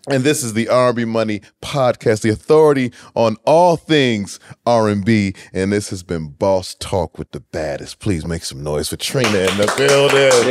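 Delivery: loud, fast emcee voice; announcer outro. Acted. Podcast host signing off the show, announcer outro. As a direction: hype the crowd to close.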